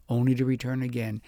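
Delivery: ordinary delivery, nothing marked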